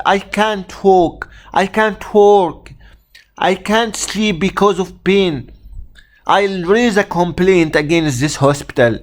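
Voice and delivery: high-pitched angry outburst; doctor breaks bad news to an angry patient, who rants back. Shown as a demonstration. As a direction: rant as a furious patient whose surgery was cancelled. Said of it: "the actor will start to shout".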